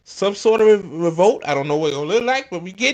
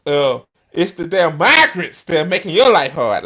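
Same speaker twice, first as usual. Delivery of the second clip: usual speaking voice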